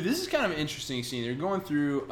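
usual speaking voice

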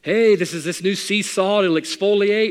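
high, loud hawker's pitch; a hint of a sidewalk salesman; pushy street vendor. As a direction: hawk sea salt at a passing couple. Preacher voices street salesman.

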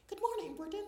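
very high, loud, piercing mimicry; high-pitched Thatcher. Guest shows host how the politician first spoke, high-pitched mimicry. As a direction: imitate her early voice, far too high. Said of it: "So from speaking like this".

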